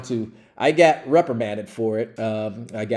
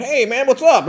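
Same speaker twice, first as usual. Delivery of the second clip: high, loud buddy hail; upbeat greeting; 10 semitones higher, 5 dB louder than usual. Retold or imitated. Acts the colleague's greeting on arrival.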